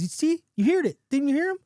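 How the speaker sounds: high, startled excitement; excited vindication. Acted. Man jumping back from the talking wolf, excited.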